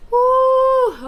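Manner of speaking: whoop. Real, not acted. Recovering from the sting.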